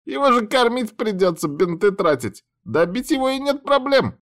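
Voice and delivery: high squeaky grumbling creature; indignant rat voice. A narrator's reading. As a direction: squeak out the complaint. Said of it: "возмущённый голос крыса".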